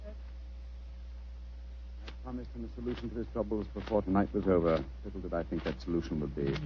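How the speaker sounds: low, bitter regret; bitter self-reproach. Acted. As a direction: blame yourself quietly over the body. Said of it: "bitter, self-accusing notes in Holmes's voice".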